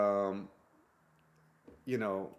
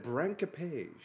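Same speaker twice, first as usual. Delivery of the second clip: put-on slow, lightly accented Branque-page; a hint of the buyer's foreign accent; 3 semitones higher, much slower than usual. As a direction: mimic the buyer mispronouncing it. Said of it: "I'm just doing the accent".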